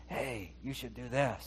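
soft, slow coaxing voice on the shoulder; shoulder angel voice. Acted. Cartoon angel on the shoulder telling you what to do, soft coaxing. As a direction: coax softly and slowly, like a little voice in the ear. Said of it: "one's telling you".

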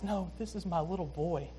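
soft, hushed and fond; tender. Retold or imitated. Father speaking of his lost son, softly tender. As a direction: say it gently, like holding your child. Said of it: "He's showing com-, tenderness".

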